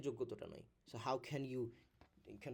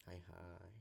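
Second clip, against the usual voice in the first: dismayed whisper; 6 semitones lower, 12 dB quieter, much faster than usual. Pretend. Mock dismay at silent class.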